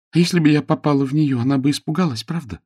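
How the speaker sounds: put-on high, fast young lady's voice; eager Jane. Jane wonders about scaring the mouse, eagerly. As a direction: rattle it off hopefully.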